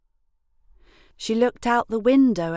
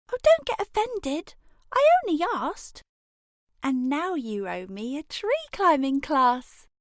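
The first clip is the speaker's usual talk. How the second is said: put-on cheeky kid voice; 8 semitones higher, 4 dB louder than usual. A narrator's reading. Elray answers the grub.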